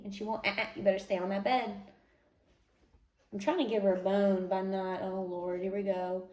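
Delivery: ordinary talk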